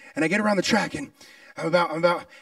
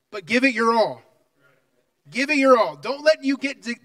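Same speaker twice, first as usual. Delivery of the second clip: ordinary delivery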